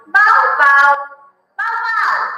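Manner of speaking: teacher playing puppies in a kennel; puppy bark. Shown as a demonstration. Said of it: "They said".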